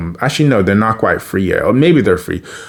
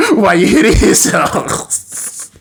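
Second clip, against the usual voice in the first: drawn-out taunt; 8 semitones higher, slower than usual. Retold or imitated. Big-brother taunt, drawn out.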